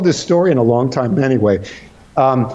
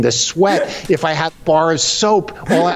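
ordinary delivery

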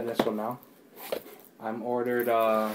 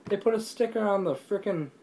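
much higher, indignant complaint; real high-pitched outrage at a sticker; exasperated; 7 semitones higher than usual. Real, not acted. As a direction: vent at the sticker ruining the box. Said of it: "It makes me mad".

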